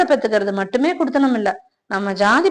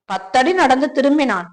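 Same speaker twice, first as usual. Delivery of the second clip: usual speaking voice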